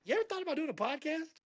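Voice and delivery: very high-pitched, tentative-sounding proposal; retold eager suggestion. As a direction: float an idea to a friend, voice way up. Retold pitch of an idea to friend.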